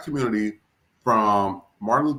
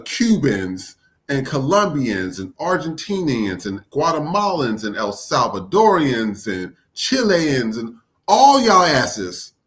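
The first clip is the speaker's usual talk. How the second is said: slow, drawn-out list building to a louder finish; exasperated roll call; 4 dB louder, slower than usual. Real, not acted. Speaker lumping every nationality together, slow exasperated roll call. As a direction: stretch each nationality, then hit the last phrase hard.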